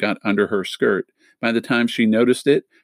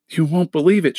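out of breath; 7 semitones higher than usual. A narrator's reading. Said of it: "she panted".

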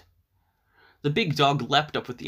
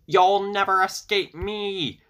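high, loud, slow witch threat; cackling witch; 7 semitones higher, 5 dB louder, slower than usual. A narrator's reading. Baba Yaga flies after the fleeing girl, high witch cry. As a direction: stretch it out high and loud, gleefully menacing. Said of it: "Baba Yaga cackled a terrible laugh".

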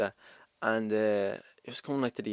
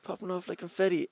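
raised, high-pitched delivery; high-pitched demo; 8 semitones higher than usual. Shown as a demonstration. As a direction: pop up high to show the upbeat tone. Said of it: "high pitch".

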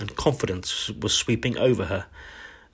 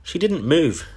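high, emphatic storybook protest; crying out; 7 semitones higher, 4 dB louder than usual. A narrator's reading. Classmates defending the girl to the headmistress, crying out. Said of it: "they cried out".

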